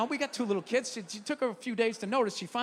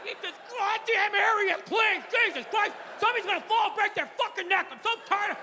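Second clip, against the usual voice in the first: raised exasperated yelling rant; mad dad rant; 7 semitones higher than usual. Retold or imitated. Man ranting at his family about a mess, higher and angry. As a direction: blow up at the family over clutter. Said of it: "You can be mad".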